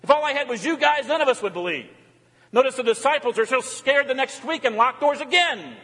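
impassioned preaching. Real, not acted. Preacher pleading the need for Jesus, impassioned. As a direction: build insistently on each repeated phrase.

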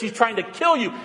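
usual speaking voice

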